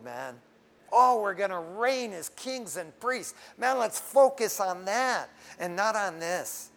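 ordinary talk

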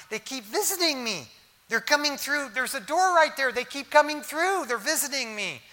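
high, urgent voice insisting it is true; acted insistence. Dying dad telling his daughter about visiting sons, high and insistent. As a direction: plead to be believed, pitched well up.

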